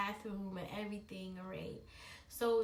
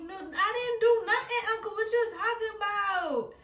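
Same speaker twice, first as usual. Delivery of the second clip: higher, louder laughing denial; 9 semitones higher, 4 dB louder than usual. Retold or imitated. Girl denying to uncle, higher laughing protest. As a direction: protest innocence too hard. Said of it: "I was like".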